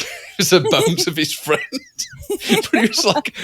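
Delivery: laughing hard and high. Real, not acted. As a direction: laugh through the words. Laughing while retelling funny skeleton.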